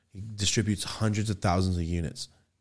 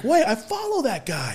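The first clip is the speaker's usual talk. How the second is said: excited recognition. Retold or imitated.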